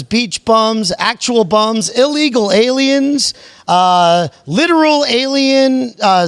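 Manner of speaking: high-pitched hollered roll-call; show-opener greeting. Acted. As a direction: greet the crowd like a hyped host, drawing out names.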